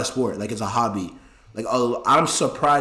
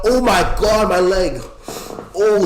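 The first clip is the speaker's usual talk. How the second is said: sudden cry of pain from a leg cramp; 6 semitones higher, 5 dB louder than usual. Real, not acted. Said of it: "I'm cramping, guys".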